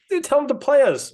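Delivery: loud and high, fired up; excited shout. Real, not acted. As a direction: call it out, hyped to play. Excited pitch to play a match.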